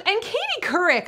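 high, mocking politician's voice; Sarah Palin impression. Retelling her jab at the media, high mock voice. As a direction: pipe it up as a mimicked grievance. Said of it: "and she goes".

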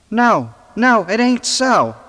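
high, loud frightened scream. Acted. Ivy screaming at Hyde in terror. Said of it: "screamed Ivy".

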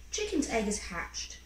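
ordinary delivery

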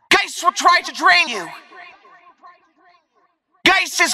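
high, urgent call-out; shouted combat warning. Acted.

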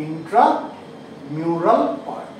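ইন্ট্রামিউরাল split into "intra" and "mural", drawn out; very slow, with a pause inside ইন্ট্রামিউরাল; slow dictation. Shown as a demonstration. Teacher repeats the term for class, slow dictation.

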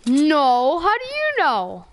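high, loud startled protest; surprised outburst. Real, not acted. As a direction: caught off guard, voice jumps up. Opponent spots him in hiding.

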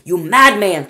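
put-on loud, high old woman's shriek. Grandma Josephine shrieking at Mr Wonka, loud and high. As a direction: shriek the insult at him. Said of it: "shrieked Grandma Josephine".

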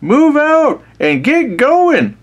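high, drawn-out commander's yell; acted battlefield shout, stretched out. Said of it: "stood up in the road yelling".

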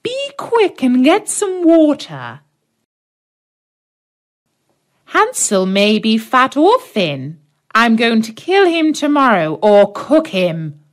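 a hint of a cruel old witch barking orders. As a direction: witch ordering Gretel to fetch water.

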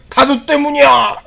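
high, slow, loud yell; acted angry outburst. Worn-out hero blaming his rival, acted angry yell. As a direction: stretch the blame into a shout.

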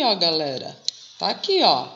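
ordinary delivery

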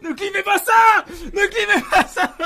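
loud, slow pleading yell; shout. Real, not acted. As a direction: shout the plea, laughing by the end. Begging chat, shouting. (supplier en criant, lentement)

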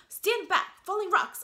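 high, loud warning call; warning shout. Acted. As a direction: yell it out to stop them in time. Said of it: "a voice warned".

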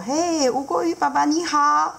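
high cheerful greeting; lightly voiced cheery fish; seahorse dad. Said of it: "他就说".

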